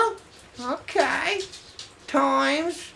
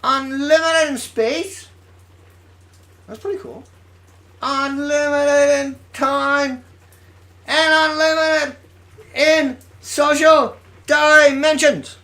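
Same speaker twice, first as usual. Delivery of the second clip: mocking repetition; 4 semitones higher, 5 dB louder than usual. Mocking the professor's claim.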